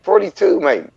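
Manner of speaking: teasing a friend about his age with mock surprise. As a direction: act amazed at the number. Mock disbelief at stated age.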